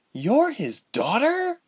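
a startled, drawn-out question; shocked exclamation. Acted. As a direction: gasp it out in disbelief. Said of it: "Ilse exclaimed".

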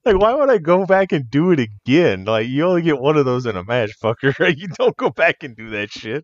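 high, loud what-are-you-thinking rant; laughing, exasperated objection; incredulous protest. Retold or imitated. Wrestler telling friend why he wouldn't do it again, incredulous. Ranting about redoing the stunt.

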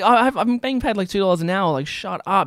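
high-pitched exasperated rant, retold; worked-up mock tirade at a customer; exasperated worker. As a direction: act out an underpaid worker losing patience. Exasperated underpaid worker snaps at customers.

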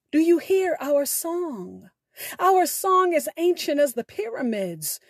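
normal talking voice

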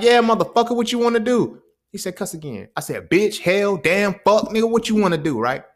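put-on teen cussing out older brother on a dare; defiant cussing. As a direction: hurl every cuss word as a dare.

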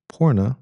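"Porna" as an isolated trial with a falling end; practised pronunciation. Shown as a demonstration. Reviewer trying the actress's name over, practised pronunciation. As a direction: rehearse the name "Porna", letting it fall. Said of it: "say that one more time".

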